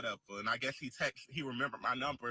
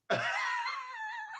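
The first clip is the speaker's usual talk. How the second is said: shrieking laugh. Real, not acted. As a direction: scream with laughter. Bursts out laughing.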